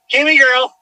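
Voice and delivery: loud, high call across a field; calling the dog. Real, not acted. Owner calling lost dog Cammy in the dark field, loud high calls.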